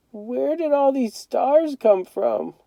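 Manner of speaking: high, soft, slow and frightened; a small boy asking in awe and fear, slowly; scared little boy. A narrator's reading. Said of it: "sounding real scared".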